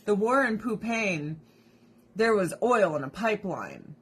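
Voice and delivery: ordinary talk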